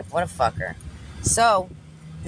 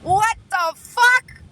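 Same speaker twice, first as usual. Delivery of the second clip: very high, loud, drawn-out disbelief; stunned outburst; 7 dB louder, much slower than usual. Real, not acted. Storyteller floored by the customer's remark, stunned loud squeal. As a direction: squeal it slowly, louder than usual.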